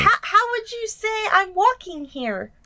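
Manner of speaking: high, drawn-out mimicking voice; slow, high imitation of a curious asker; mock request. Mimicking what classmates asked him to say.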